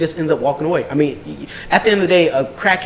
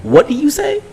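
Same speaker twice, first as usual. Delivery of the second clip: much higher, disbelieving ask; shocked question; 12 semitones higher than usual. Retold or imitated. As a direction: ask as if you misheard something awful. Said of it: "I was like".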